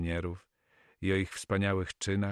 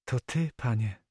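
put-on higher, joyful young lady's greeting; 5 semitones higher than usual. A narrator's reading. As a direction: greet him with delight, pitched up. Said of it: "powitała go z radością". (radosne powitanie)

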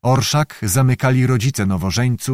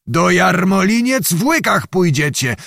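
stern threat; 6 semitones higher than usual. A narrator's reading. Zagłoba threatens to bind them.

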